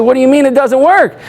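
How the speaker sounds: retold disbelief, pitch lifted high; incredulous challenge. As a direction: push back in mock disbelief, rising high on the question. Disputes the driver's refusal in retelling.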